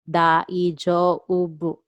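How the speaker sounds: "Daijoubu" very slow, each sound separated; "Daijoubu" slowly spelled out by ear; syllable-by-syllable model. Shown as a demonstration. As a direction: stretch out "Daijoubu" for learners. Teacher breaks word into syllables.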